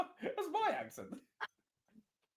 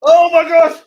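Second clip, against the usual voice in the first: shocked shout; 14 semitones higher, 14 dB louder, slower than usual. Real, not acted. Shock at surprise cash-in.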